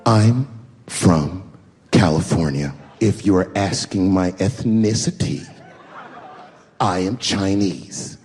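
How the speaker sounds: low, slow condescending voice. Retold or imitated. Pregnant wife snubbing the comedian, low and condescending. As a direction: talk down to him, slowly. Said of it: "She goes, very condescendingly".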